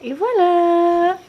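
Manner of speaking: et voilà étiré, aigu et plus fort, lent; traîner le mot pour faire sourire; voix de bébé. Real, not acted. Maman conclut pour bébé. (maman à sa fille, voix aiguë étirée)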